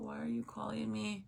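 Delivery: normal talking voice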